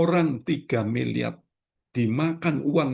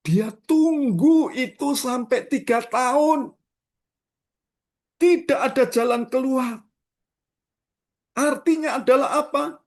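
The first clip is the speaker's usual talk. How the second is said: high, loud preacher's cry to the congregation; fiery preaching; 12 semitones higher, 5 dB louder than usual. Acted. Pastor urges the congregation, preaching at full force. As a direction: lift the voice and preach it hard.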